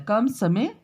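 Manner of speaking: ordinary talk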